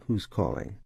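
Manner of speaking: a hint of a curt receptionist; lightly voiced assistant. Shown as a demonstration. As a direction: ask it flatly like a gatekeeper. Assistant voiced in phone demo.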